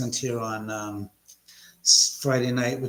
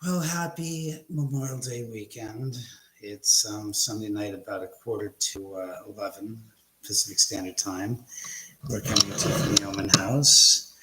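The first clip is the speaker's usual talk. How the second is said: ordinary delivery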